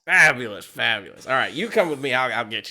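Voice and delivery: loud gleeful fabulous; delighted merchant. Acted. Merchant thrilled the hero will work for him. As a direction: pounce on the deal with glee.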